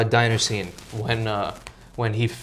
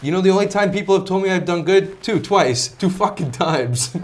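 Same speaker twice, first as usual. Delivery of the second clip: Tony quote; 6 semitones higher than usual. Retold or imitated. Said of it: "he goes".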